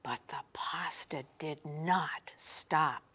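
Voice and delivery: hushed, breathy narration; whisper. A narrator's reading. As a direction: drop to a whisper for suspense. Dramatic hush after the yell.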